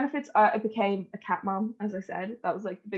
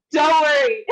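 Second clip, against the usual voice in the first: much louder, higher, laughing it out; 7 semitones higher, 7 dB louder than usual. Real, not acted. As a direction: reassure through laughter. Laughing reassurance to friends.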